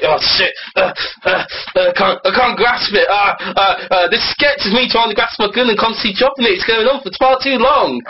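flustered, rising comic complaint; fumbling wounded officer. Acted. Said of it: "you're the wounded one's like".

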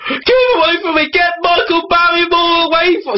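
high comic shriek; panicked plea. Acted. Acted panicked officer.

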